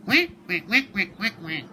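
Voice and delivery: loud, high quacking duck; duck quacks. Acted. Duck voice on phone.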